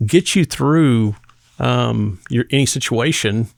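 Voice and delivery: ordinary delivery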